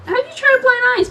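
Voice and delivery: high put-on lady voice; Pepper voice. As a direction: pitch up for Pepper.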